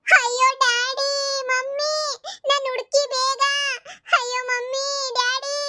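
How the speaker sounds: put-on a scared small boy begging his parents; crying child. Kidnapped child calls his parents. (ಎತ್ತರದ ಧ್ವನಿಯಲ್ಲಿ ಅಳುವ ಪುಟ್ಟ ಮಗು)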